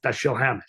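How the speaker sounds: Dashiell said halfway between DASH-ul and duh-SHEEL; a loose, in-between Dashiell Hammett; blended Dashiell. Shown as a demonstration. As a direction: Dashiell with the stress left vague. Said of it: "kind of a combination of both".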